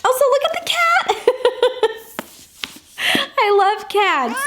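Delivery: very high, squealing gush over a cat. Real, not acted. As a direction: coo at the cat on the cover. Spotting cat on the cover.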